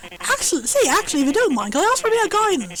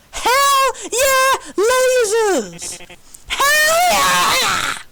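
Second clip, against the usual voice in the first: excited cheer; 6 semitones higher, much slower than usual. Real, not acted. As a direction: cheer like a fan at a show. Fan cheering for lasers.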